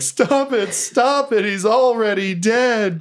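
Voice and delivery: high, pleading spectator's cry; pleading wail, slowing on the last words; horrified cry. Acted. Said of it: "you hear someone cry from the audience".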